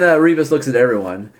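ordinary delivery

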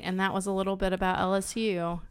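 ordinary talk, nothing marked

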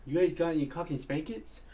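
speech impediment voice. Retold or imitated. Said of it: "guy with a speech impediment".

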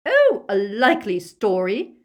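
loud, high, mocking disbelief; scornful outburst. A narrator's reading. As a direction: jeer at the prisoner's excuse. Bellatrix scorning the prisoner's claim.

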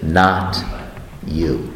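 very low, fading, split by a pause; mirror's final verdict. Acted. Mirror of the law answering everyone, low and fading. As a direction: let the verdict sink low and die away.

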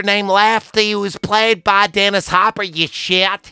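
high, know-it-all character voice; high-pitched pedantic movie nerd; nerdy high voice. Retold or imitated. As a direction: lecture in a high nasal-free geek voice. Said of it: "and he goes".

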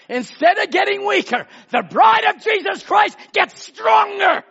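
high, loud fiery proclamation; preaching shout. Retold or imitated. Preacher quoting Brother Branham to the church, a loud fiery shout. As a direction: thunder it out to the church.

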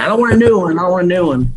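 high, insistent Alabama mom voice; mom in Alabama accent. Retold or imitated. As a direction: mom refusing a new grill, in a Southern accent. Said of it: "then she's like".

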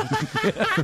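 high burst of laughter; high-pitched amused laugh. Real, not acted. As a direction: crack up at the joke. Joke about kidnapping the president.

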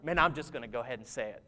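low, fast, quiet mumble; muttered aside. Retold or imitated. Speaker before blurting words out in conversation: low, fast mutter. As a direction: rush it under your breath. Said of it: "and then you're like".

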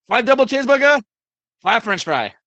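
put-on loud, high broken English with a Greek accent. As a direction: bark the order like a Greek diner owner. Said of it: "in his, uh, Greek accent".

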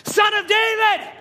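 high, drawn-out shout for help. Acted. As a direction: shout it out over a crowd. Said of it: "he'd be shouting out".

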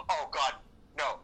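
usual speaking voice

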